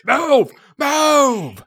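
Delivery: barked high, loud orders; fed-up barked instructions. Retold or imitated. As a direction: bark it like a fed-up guard. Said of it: "a T S A agent just yells at me".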